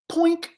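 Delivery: high little poink; popping effect. Acted. Storyteller freeing the bear's leash, popping effect. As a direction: pop it out short.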